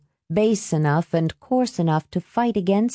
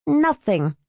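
cold refusal; 6 semitones higher than usual. A narrator's reading. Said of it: "Helen said, blanching".

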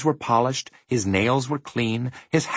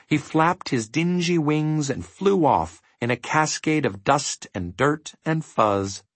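usual speaking voice

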